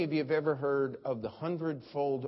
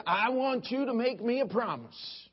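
Schambach impression; 6 semitones higher, 3 dB louder than usual. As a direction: plead like a radio preacher. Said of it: "here was Brother Schambach, and here was his plea".

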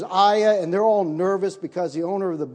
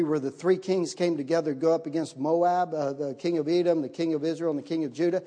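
ordinary delivery

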